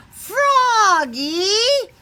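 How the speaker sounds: high loud call across the bus; yelled name. Acted. Max calling out to Froggy, high loud yell. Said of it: "yelled his friend Max".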